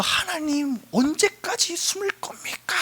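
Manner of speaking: acted plaintive protest; crying out to God. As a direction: wail the question up high without extra volume. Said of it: "한숨 쉬면서 소리 질렀던".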